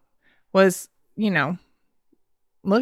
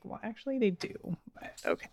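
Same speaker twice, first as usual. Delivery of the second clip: barely voiced aside, trailing to a whisper; muttered under breath; 14 dB quieter than usual. Mom muttering back at her son under her breath. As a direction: mutter it so the kid can't hear. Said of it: "And I'm like".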